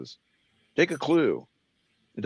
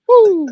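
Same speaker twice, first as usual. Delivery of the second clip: high loud falsetto whoop; high piercing woo; 12 dB louder than usual. Pretend.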